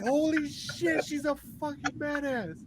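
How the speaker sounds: high, slow, awed exclamation. Retold or imitated. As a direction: gasp it out in disbelief. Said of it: "I'm like".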